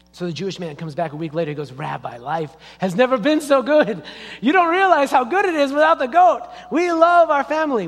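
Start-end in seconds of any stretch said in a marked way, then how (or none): 1.7-7.9 high, loud, hammed-up elation; acted joy, building higher and louder; overjoyed. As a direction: gush to the rabbi with relief.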